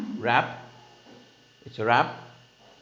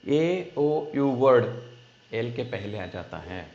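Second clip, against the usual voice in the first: usual speaking voice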